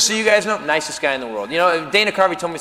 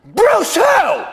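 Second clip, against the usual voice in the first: high, loud shout of disbelief; incredulous shout; 13 semitones higher, 5 dB louder than usual. Acted. Comic to audience on hearing Bruce is a fan, incredulous shout. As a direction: yell it out high, as if you can't believe it. Mocking shout at a famous name.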